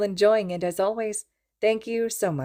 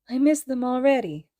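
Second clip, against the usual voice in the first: put-on higher, tearful child's voice; crying child; 7 semitones higher than usual. A narrator's reading. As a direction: hiccup through childlike tears. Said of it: "Izuku hiccuped".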